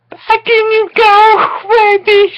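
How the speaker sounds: high, loud hammed-up sob; mock sobbing. Mother sobbing to her dead baby, mock wailing high. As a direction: wail it like a grieving mother, over the top. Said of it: "she sobbed".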